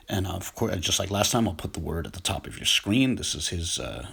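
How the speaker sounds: ordinary talk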